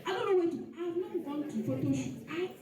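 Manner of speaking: ordinary talk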